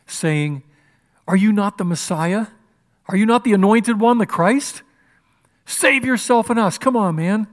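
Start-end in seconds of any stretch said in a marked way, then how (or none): 1.3-7.5 loud, raised challenge; railing. Retold or imitated. Criminal railing at Jesus on the cross, loud and scornful. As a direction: jeer it out, pitched high and insistent. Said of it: "railed at him".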